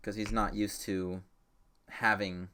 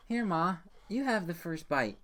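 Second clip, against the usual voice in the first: put-on sweet kid voice; 7 semitones higher than usual. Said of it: "he goes".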